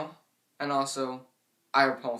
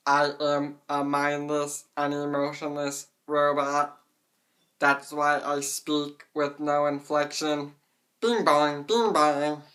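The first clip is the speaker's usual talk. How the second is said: slow, flat, louder robot voice; emotionless robot; 5 dB louder, slower than usual. Shown as a demonstration. Robot voice mocking a speaker with no inflection, flat and mechanical. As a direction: speak like a mindless machine, bing bong. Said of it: "mindless, emotionless robot".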